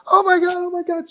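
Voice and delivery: high-pitched mock scream; imitating a panicked shriek; screaming city girl. City girl hooked by the fishing lure, screaming. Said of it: "she's screaming".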